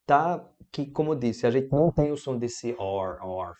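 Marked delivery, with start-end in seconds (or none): 3.2-3.5 "or" with a closed, curled R; closed R sound. Shown as a demonstration. Teacher showing learners a sound foreign to Portuguese, closed-R "or". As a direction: say "or" with the R held tight, unlike Portuguese. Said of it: "fechado assim no português".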